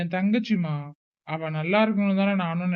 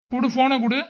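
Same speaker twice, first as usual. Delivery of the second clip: higher, louder, demanding the phone; snatch it, bark the order; older brother ordering his sister to hand over the phone, angrily; demanding shout; 6 semitones higher, 3 dB louder than usual. Acted.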